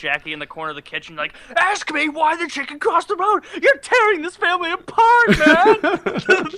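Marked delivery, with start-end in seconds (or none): 1.6-6.5 put-on much higher, louder, yelling kid voice; mock angry kid. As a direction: scold dad for the bad jokes, hammed up. Kid yelling at joking dad.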